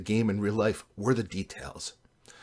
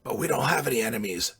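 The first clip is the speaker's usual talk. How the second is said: croaky character voice; croaking; 3 semitones higher than usual. A narrator's reading. Sanjay objects to the party name, croaking. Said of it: "Sanjay croaked".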